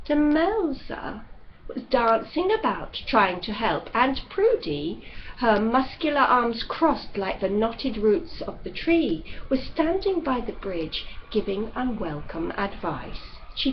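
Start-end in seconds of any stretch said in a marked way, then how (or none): none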